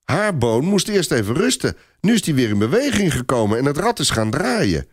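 put-on high child voice explaining seriously; high-pitched, childlike seriousness; earnest little boy. A narrator's reading. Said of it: "zegt kleine Robert ernstig".

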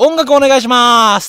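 calling out. Retold or imitated. Actor desperately cues the sound crew mid-rehearsal, calling out. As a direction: holler it across the theatre, stretching the last word. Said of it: "音響さんの方角に向かって".

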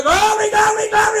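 hammed-up holy shout; mock shouting glory. Preacher mocking a congregant shouting glory, loud high mock shout. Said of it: "Don't say".

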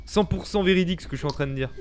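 ordinary talk, nothing marked

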